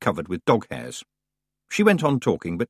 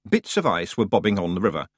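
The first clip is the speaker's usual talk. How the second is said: usual speaking voice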